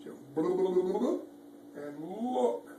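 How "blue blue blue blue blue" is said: loud sing-song jump sound. Acted. Jumping sound effect.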